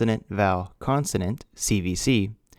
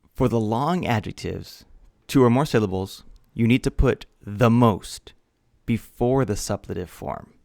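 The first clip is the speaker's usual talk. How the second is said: ordinary talk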